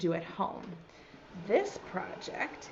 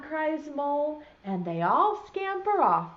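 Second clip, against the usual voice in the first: ordinary delivery, nothing marked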